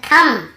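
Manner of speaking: "थंब" said tham, closing on M; silent B. Shown as a demonstration. Teacher corrects the reading for students, "थंब" said tham. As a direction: drop the final B of "थंब". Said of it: "यहाँ पर भी बी को नहीं पढ़ना है".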